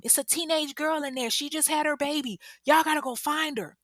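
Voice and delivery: high urgent pleading; urgent plea. Retold or imitated. Neighbors telling the firefighters, high and urgent. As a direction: beg the firefighters to hurry. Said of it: "the neighbors are telling them".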